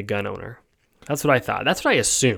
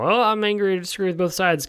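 mock outrage pitched much higher; mock angry stance; 8 semitones higher than usual. Parodying a generic political hot take, mock outraged. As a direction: parody a shallow both-sides rant, lightly. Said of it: "to just throw out these".

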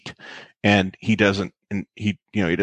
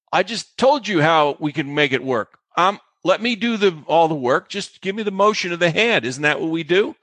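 exasperated retelling; 7 semitones higher than usual. Retold or imitated. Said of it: "it was infuriating".

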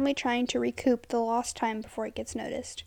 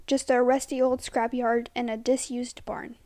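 ordinary delivery

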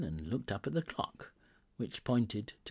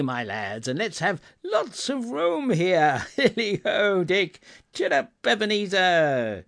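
high, loud, exuberant hollering; rally the lads with merry whoops; hearty old boss in full cry; jovial shouting; 7 semitones higher, 7 dB louder than usual. A narrator's reading. Fezziwig shouting merrily to his lads.

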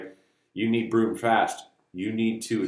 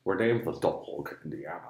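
Scottish movie-dad impression; 6 semitones lower, 5 dB quieter than usual. Repeats the Connery movie quote.

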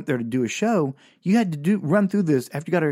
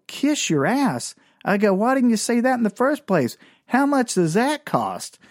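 mock innocence; 9 semitones higher than usual.

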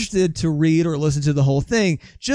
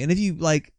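normal talking voice

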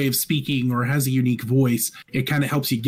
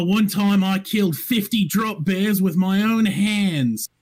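put-on Australian accent; 6 semitones higher, slower than usual. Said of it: "play an Australian monk".

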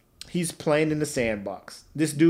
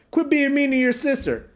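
high scolding mom voice; mock mom; 9 semitones higher than usual. Imitating Caillou's mom scolding him in a high voice. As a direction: nag him like a fed-up mother. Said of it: "his mom's just like".

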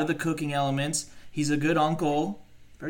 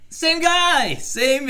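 high loud exclamation; excited; 12 semitones higher, 6 dB louder, much slower than usual. Real, not acted. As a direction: blurt it out in excitement. Emphatic realization.

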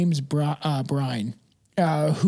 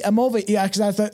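ordinary talk, nothing marked

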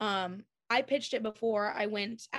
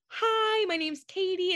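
high, louder starstruck hello; nervous high greeting; 10 semitones higher, 5 dB louder, slower than usual. Retold or imitated. Meeting her idol Ashley, a high nervous fan greeting. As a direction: squeak out your name to a hero. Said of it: "I was like".

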